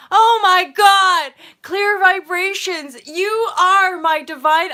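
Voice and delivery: high, loud, slow swooning praise; gushing excitement. Acted. Vlogger gushing to a viewer, high, loud and slow. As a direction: gush over the viewer like a fan. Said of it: "I'm just getting all hot and bothered".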